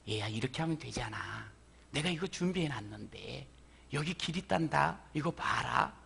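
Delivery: soft, low, gentle guidance; tender voice. Acted. God gently showing believers the way, soft and tender. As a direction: speak softly like a parent showing the way. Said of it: "하나님 앞에 서 있는 우리에게요".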